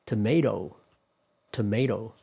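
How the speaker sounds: tomato with "ay" in the middle and a tapped t; American pronunciation. Shown as a demonstration. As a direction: say tomato as "tuh-MAY-doh". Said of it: "This is quite famous".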